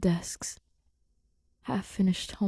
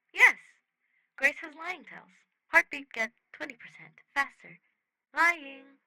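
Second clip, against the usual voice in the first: higher, matter-of-fact alien report; eager alien Rocky voice; 7 semitones higher than usual. Acted. Rocky happily telling Simon about Grace's lying tells, high alien voice. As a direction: list the evidence like an eager alien.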